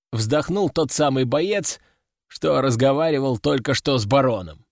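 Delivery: ordinary delivery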